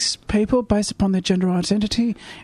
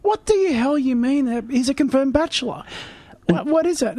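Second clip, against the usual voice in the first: incredulous; 5 semitones higher than usual. Real, not acted. Mock outrage at old phrase.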